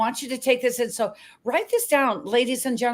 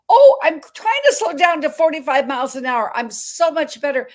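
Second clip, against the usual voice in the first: rushing self quote; 4 semitones higher, 6 dB louder than usual. Retold or imitated. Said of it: "I remember, I thought".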